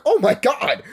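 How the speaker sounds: high, loud horrified cry; shocked. Retold or imitated. Reacting to the dog being eaten, cried out high. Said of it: "And I'm like".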